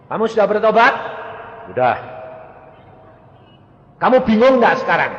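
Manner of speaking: high, loud probing; firm questioning. Retold or imitated. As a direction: press the sick man. Pastor questioning sick man. (menirukan pendeta yang bertanya keras)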